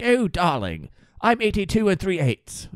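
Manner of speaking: put-on British accent, precise about her age; posh British old lady. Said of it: "She's like".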